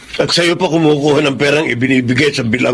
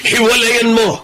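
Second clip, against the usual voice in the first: stern command; 6 semitones higher, slower than usual. Acted. Father orders son to separate.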